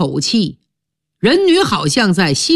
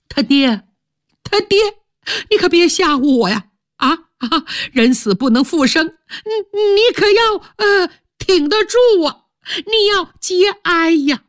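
much higher, anxious stepmother rushing her pleas; pleading stepmother; 9 semitones higher than usual. Acted. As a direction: coax the stunned husband, high and quick.

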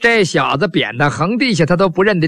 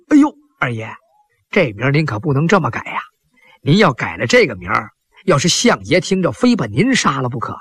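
lower, hurried servant voice; servant's warning; 6 semitones lower than usual. Acted. Servant warns Yan Er the prime minister will kill him, low and hurried. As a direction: warn the master, low and quick.

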